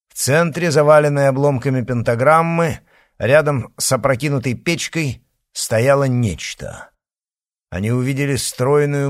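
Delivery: plain reading voice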